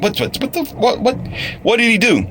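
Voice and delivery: high-pitched stammering disbelief, imitated; hammed-up startled questioning; mock shocked onlookers. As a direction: stammer it high like a baffled bystander.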